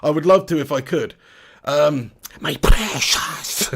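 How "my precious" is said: slow, rasping whisper; creepy creature voice. Acted. Sudden creature impression after accent requests.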